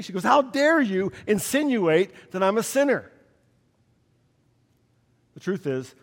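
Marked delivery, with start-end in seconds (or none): 0.2-3.1 high, indignant, stress on dare; retold outrage. Hospital woman scolding the young chaplain, in outrage. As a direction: replay her offended outburst. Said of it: "one woman was just seething with outrage".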